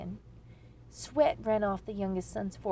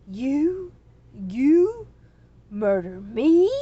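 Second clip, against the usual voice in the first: much louder, slow astonished dragon voice; flabbergasted disbelief; 3 semitones higher, 14 dB louder, much slower than usual. A narrator's reading. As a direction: boom it out in sheer disbelief.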